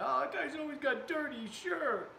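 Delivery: high, mocking voice of a French commenter. Mocking a French viewer's comment in a high voice. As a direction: sneer it like the snooty critic. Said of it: "He said".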